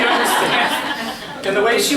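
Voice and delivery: amused, laughing question. Real, not acted. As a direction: let the laugh carry the words. Laughing at Harlequin romance joke.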